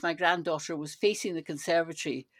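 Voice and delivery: ordinary talk, nothing marked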